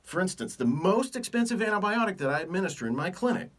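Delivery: normal talking voice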